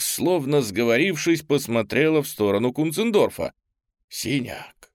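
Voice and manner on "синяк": hushed, whispered name. Acted. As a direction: breathe it out softly. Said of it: "пронёсся шёпот".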